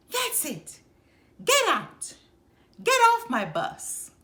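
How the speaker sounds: high, loud enraged shout; furious driver. Acted. Bus driver ordering her off his bus, loud furious high shout. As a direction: explode and throw her off the bus. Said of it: "It made the driver madder than ever".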